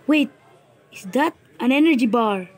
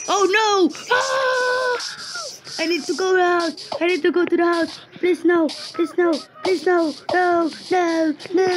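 higher, frantic cries of please no; panicked pleading; 7 semitones higher than usual. Real, not acted. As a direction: beg for your life as it chases you. Chased by mob, panicking.

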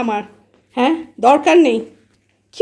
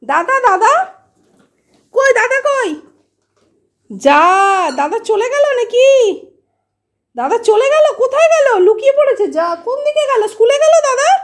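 high, sing-song talk to a pet; 12 semitones higher, 4 dB louder than usual. Real, not acted. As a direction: coax the pet in a high playful voice. Calling pets playfully. (উঁচু গলায় পোষ্যের সঙ্গে আদুরে কথা)

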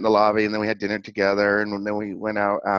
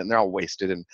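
ordinary delivery

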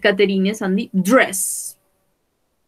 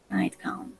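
whispered word; 11 dB quieter than usual. Shown as a demonstration. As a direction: whisper nightgown softly, unvoiced. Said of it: "teacher whispers word".

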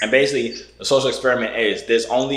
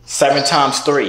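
slow and louder, each word spaced out; slow repeat; 4 semitones higher, 4 dB louder, slower than usual. Real, not acted. Repeating the question slowly to a girl on camera. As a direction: spell it out slowly for a stranger who didn't hear.

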